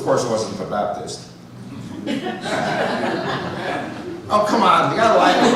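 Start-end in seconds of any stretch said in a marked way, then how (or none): 1.6-4.2 a quiet, amused laugh at his own pun; soft breathy laugh. Real, not acted. Speaker chuckles at his own Baptist pun, soft laugh. 4.3-5.5 laughing. Real, not acted. Laughing through his own joke.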